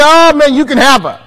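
loud, high hammed-up shrug; mock dismissive brother. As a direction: toss her away cheerfully. Brother giving up Ruth.

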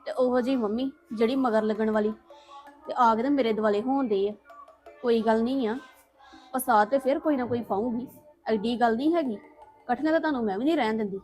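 soft, sulky muttering to herself; quiet grumbling. Acted. Daughter muttering to herself after the family fight, quiet and sulky. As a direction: grumble under your breath, alone and resentful.

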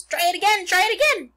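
high, loud squawked urging; goose cheering on. A narrator's reading. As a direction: egg him on as a shrieking goose. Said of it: "cried the goose".

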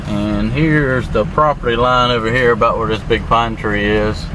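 usual speaking voice

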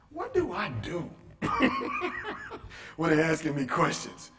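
laughing through the question; high laugh breaks into the words. Real, not acted. Coach laughs at her own question, laughing.

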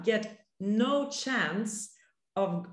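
normal talking voice

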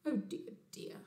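small, hushed, breath-only regret; dismayed Bear. Acted. Bear told off by the owl, hushed dismay. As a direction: shrink back after a scolding. Scolded Bear, downcast in story.

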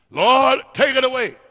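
loud, high plea to God; pleading prayer. Acted. Acting out Paul's plea to God.

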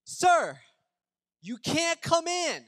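a hammed-up strict doorkeeper; stern door lady. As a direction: block him at the door, sir first. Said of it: "the lady goes to me and goes".

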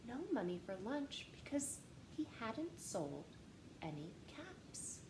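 ordinary delivery

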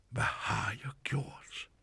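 slow sighing whisper; sighed. A narrator's reading. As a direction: breathe it out in regret. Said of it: "sukkede han".

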